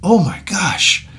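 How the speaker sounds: slow, amazed gasp; mock astonishment; awed surprise. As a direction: marvel at the screen. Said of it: "he's like".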